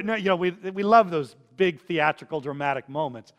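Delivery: usual speaking voice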